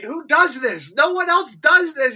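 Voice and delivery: loud, high shouted questions; retold screaming. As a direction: yell it in disbelief at the screen. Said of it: "I kept screaming".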